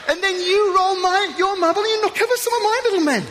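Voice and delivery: a boy playing soldiers, delighting in it; childlike glee. Preacher playing out a toy soldier game, high and gleeful.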